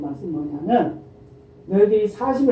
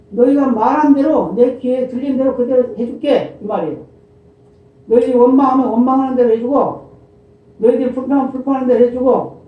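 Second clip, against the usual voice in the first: higher, louder fervent preaching; preaching at full force; 5 semitones higher, 5 dB louder than usual. Real, not acted. Preacher pressing God's warning on the congregation, loud and high. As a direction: raise the voice and drive it home.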